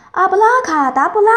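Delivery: high, loud magic chant; shouted spell. A narrator's reading. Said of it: "大喊一声".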